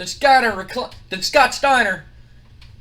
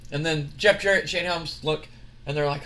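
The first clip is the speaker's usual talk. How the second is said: ordinary talk, nothing marked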